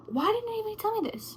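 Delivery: high, fast, amazed; surprised relief. Retold or imitated. Patient hearing the reassuring test odds, surprised. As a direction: blurt it out in disbelief. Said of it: "I was like".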